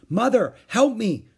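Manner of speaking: overwhelmed call for help; cried. A narrator's reading. Beezus crying out to Mother for help. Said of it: "cried Beezus".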